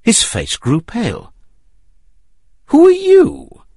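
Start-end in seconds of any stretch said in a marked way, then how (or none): 2.7-3.6 high, frightened question; nervous. A narrator's reading. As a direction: blurt it out in fear. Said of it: "he asked in a nervous voice".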